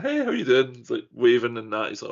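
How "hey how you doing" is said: fake casual greeting. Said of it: "I'm like".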